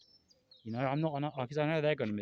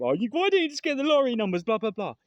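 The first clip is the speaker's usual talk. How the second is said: higher, louder mocking voice of doubters; mocking sceptic; 9 semitones higher, 7 dB louder than usual. Mimicking doubters who ask why he won't pick lottery numbers, with a mocking tone. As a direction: sneer it like a know-it-all sceptic. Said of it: "Now, people think".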